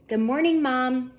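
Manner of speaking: high, loud happy morning call; cheerful call. A narrator's reading. Lucy greeting her mom from the kitchen doorway, called out cheerfully. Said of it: "Lucy shouted".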